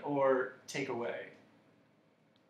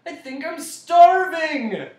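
high, very loud, drawn-out wail; anguished gasp; 14 semitones higher, 15 dB louder, slower than usual. A narrator's reading. The bug suddenly far hungrier, anguished wail. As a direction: wail it out at the top of the voice. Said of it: "gasped the bug".

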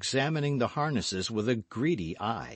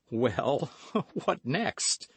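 high-pitched, amused question with a chuckle; helplessly amused, voice lifting; laughing despite himself; 6 semitones higher, much slower than usual. A narrator's reading. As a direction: ask it through a reluctant laugh. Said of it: "laughing in spite of himself".

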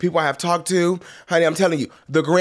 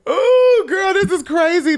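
excited shriek; 5 dB louder than usual. Real, not acted. Shrieks over juicy gossip.

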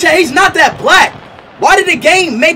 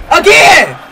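loud shout; 2 semitones higher, 4 dB louder than usual. Real, not acted. Repeat pull.

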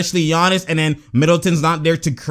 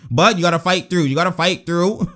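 ordinary talk

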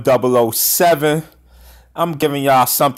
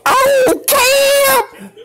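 high excited whoops; squealing hype watching highlights; hyped yelling; much slower than usual. Real, not acted. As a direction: shriek with delight at the play.

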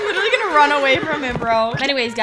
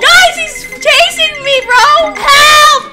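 high, loud cries for help; hammed-up panicked shouting; 8 semitones higher, 6 dB louder, slower than usual. Player chased by a fan wanting a kiss, panicked shouting. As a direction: yell it out while being chased, in mock terror.

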